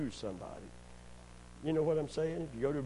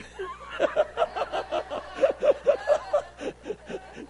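long wheezy laugh without words; 5 dB louder than usual. Real, not acted. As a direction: crack up at your own joke. Laughs at own tender aside.

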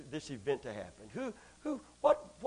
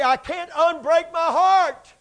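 heartbroken person; 8 semitones higher, 11 dB louder, slower than usual. Retold or imitated. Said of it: "and you say".

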